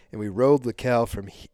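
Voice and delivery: normal talking voice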